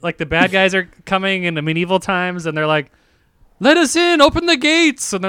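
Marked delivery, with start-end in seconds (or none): 3.6-5.1 mock shouting invaders. Acting medieval invaders at gate.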